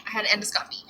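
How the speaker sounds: whispered aside to herself. Real, not acted. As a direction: breathe it out with no voice. Repeats the word as an aside.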